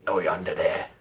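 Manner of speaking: low, rasping gruff man. Acted. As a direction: growl it like a menacing stranger. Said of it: "a gruff voice say".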